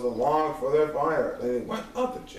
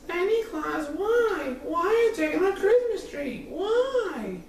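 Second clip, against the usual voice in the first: put-on high little girl's pleading voice, tearful on the question; small child's voice. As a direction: play tiny Cindy Lou, high and upset. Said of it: "stared at the prince and said".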